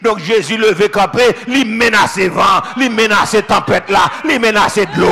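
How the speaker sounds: loud, rhythmic Haitian sermon cadence; fiery pulpit preaching building up; full-force preaching. Acted. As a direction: preach it out to the whole church. Preaching to the congregation.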